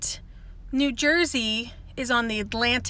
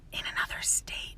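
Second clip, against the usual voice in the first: whispered sarcastic aside; unvoiced, barely audible sneer; snarky whisper; 14 dB quieter than usual. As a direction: breathe it out as a parting dig. Sarcastic final jab.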